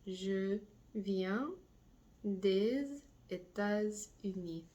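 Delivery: des États-Unis drawn out slowly; slow last take. Shown as a demonstration. Teacher giving the final slow repetition to students. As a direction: spell it out slowly for learners. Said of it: "Last time".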